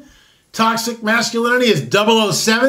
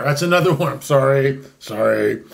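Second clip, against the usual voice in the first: usual speaking voice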